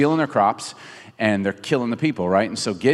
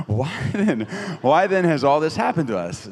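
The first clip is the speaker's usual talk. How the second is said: put-on higher, playful British accent; 5 semitones higher than usual. Preacher playing Gideon questioning the angel, mock British accent. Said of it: "he said it in a British accent".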